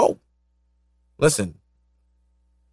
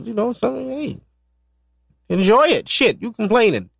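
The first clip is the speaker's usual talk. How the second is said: excited rant; 8 semitones higher than usual. Real, not acted. Excited rant, much higher pitch.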